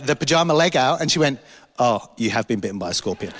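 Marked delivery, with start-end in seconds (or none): 1.8-3.3 low imitation of the wife; lightly voiced retold realisation; wife conceding. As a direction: drop low for her sheepish admission. Wife's retold realisation, voice dropped.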